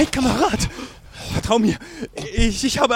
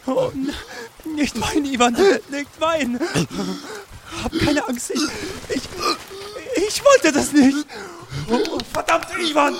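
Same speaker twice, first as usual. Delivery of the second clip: higher, shaken and pleading; horrified; 5 semitones higher than usual. Acted. Soldier panicking over the wounded enemy he stabbed, horrified. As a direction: stammer out the horror at what you did.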